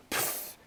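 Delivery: soft blown puff of wind; puffing noise. A narrator's reading. Kitten breaking wind, soft puff noise. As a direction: blow the word out quietly as an escaping puff.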